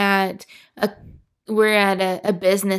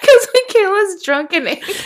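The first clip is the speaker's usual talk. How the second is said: laughing; 11 semitones higher, 4 dB louder than usual. Real, not acted. Laughing while explaining.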